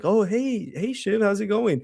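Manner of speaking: high, syrupy fake-friendly greeting; mock sweet Logan. Mocking Logan greets defeated Shiv.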